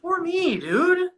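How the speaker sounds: high, whiny mock self-pity. Mocking his old excuses with a high, whiny self-pity. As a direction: whine it like a self-pitying drunk.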